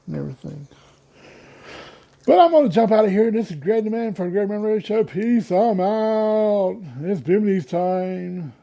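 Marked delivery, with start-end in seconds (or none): 2.3-8.5 louder, higher hyped host voice; upbeat show outro; radio sign-off. Acted.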